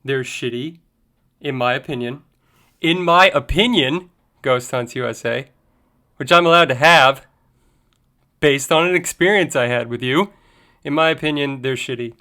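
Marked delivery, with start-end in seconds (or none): 2.8-12.1 louder, forceful restatement; loud emphatic. Real, not acted. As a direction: hammer the opinion home. Repeats his opinion, much louder.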